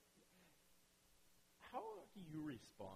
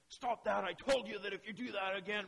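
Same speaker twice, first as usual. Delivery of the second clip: yelling parent; 5 semitones higher, 9 dB louder than usual. Retold or imitated. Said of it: "parents start yelling at their kids".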